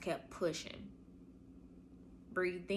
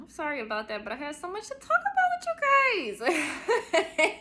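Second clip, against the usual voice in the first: much higher, laughing through the words; laughing apology; 10 semitones higher, 8 dB louder than usual. Real, not acted. Apologizing to listeners for the long episode, laughing. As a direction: giggle as you say it.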